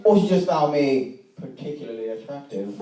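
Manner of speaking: ordinary talk, nothing marked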